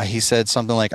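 ordinary talk, nothing marked

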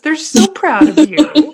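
high, loud, slow gush of affection. Real, not acted. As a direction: swoon over how proud they are. Gushing about proud parents.

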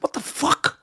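whispered, stunned what the fuck; baffled whisper. Retold or imitated. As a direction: mutter it under your breath in disbelief. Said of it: "I was like".

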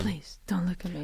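timid little whisper. Pretend. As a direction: hide and whisper it. Mock celebrity hiding from paparazzi.

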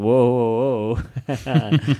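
lightly voiced startled whoa; a hint of panicked Howard Stern; mock alarm. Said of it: "Howard's going".

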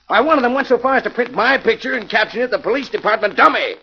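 exasperated outburst. Acted. Inspector to Jim, exasperated about the papers, indignant outburst. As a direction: fire it back, fed up and rushed.

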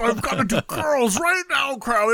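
high, hyped-up gushing mimicry; over-the-top pumped-up fanboy parody; mocking impression of co-host. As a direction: exaggerate your friend's excitement in a goofy high voice. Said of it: "none of this is what I said".

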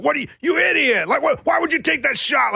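high, yelling at the TV; mock angry fan. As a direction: imitate a fan screaming at a bad shot. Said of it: "how Lunchbox watches all the games".

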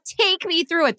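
lightly mocking reporter voice; mocking own question. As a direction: mimic the question you regret asking.

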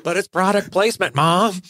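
put-on high, whiny teen voice; whiny kid. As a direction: protest like a bratty kid. Playing kid answering mom.

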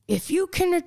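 high-pitched mock motivational dad; put-on dad voice. Daughter imitating her Mister positivity dad, put-on motivational voice. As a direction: imitate dad's slogan in a silly high voice. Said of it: "I don't know why I'm talking like that".

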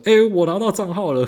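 high, cheerful lady caller; mock high delighted caller; happy customer. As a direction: chirp it up high. Customer happily calls back.

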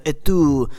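a lightly put-on French accent for a Yeti; mock French Yeti. Playing the Paris Yeti at its border, mock French accent. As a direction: ham up a lovelorn French Yeti.

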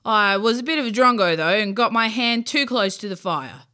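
loud, booming creature with Aussie slang; big friendly monster speaking up; deep voice. Acted. Bunyip explains to campers, booming creature voice. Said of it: "it said in a deep voice".

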